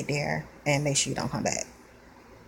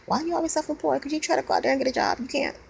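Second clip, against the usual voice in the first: higher, mocking voice of people who question her; nosy people asking; 10 semitones higher, faster than usual. Venting about people who don't understand, mock questioning. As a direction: poke at her the way others do. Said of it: "People never understand".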